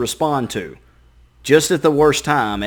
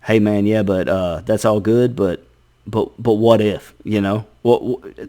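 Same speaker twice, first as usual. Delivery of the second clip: lower, slow, insinuating brain voice; sly inner voice; 5 semitones lower than usual. Retold or imitated. Brain tempting the sleepless person, low insinuating voice. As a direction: coax with a doubt. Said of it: "your brain goes".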